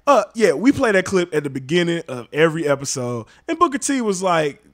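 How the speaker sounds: normal talking voice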